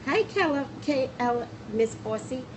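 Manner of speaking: usual speaking voice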